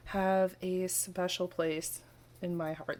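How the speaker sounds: ordinary talk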